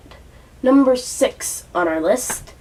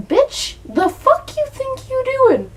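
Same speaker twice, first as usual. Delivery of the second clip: high, slow, disbelieving scold; squeaky outraged demand; incredulous rant; slower than usual. Real, not acted. Ranting at the character.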